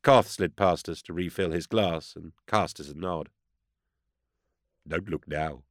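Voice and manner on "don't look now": softened, discreet aside; low murmur. A narrator's reading. Rassi warning his friend of someone approaching, low murmur. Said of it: "Rassi murmured".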